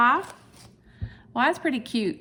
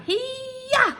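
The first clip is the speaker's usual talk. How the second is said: karate yell. Acted. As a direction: chop the air with a playful kiai. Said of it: "and then you karate".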